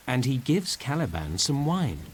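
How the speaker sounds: ordinary delivery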